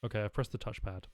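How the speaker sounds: normal talking voice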